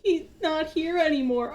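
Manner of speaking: a kid bravely admitting bad news; lightly voiced sad little girl; sad child voice.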